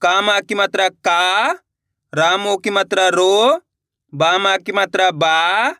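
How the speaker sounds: slowed, syllable by syllable, joining each vowel sign; walk them through every sound; slow blending demo. Shown as a demonstration. Teacher showing pupils how the vowel sign joins, slow demo. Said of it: "देखो किस तरीके से है।".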